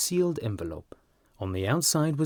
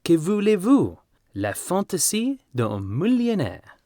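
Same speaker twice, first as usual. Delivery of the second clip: high, louder French flourish; French quip; 9 semitones higher, 5 dB louder than usual. A narrator's reading. Trevor shrugging off the baron's whim in French. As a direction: toss off the French with flair.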